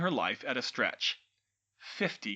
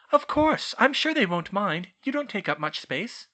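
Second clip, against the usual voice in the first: put-on lightly higher girl's voice; a hint of brisk schoolgirl; young witch; 8 semitones higher, 6 dB louder than usual. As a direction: Hermione inviting the elf, lightly voiced girl. Hermione reassures Dobby.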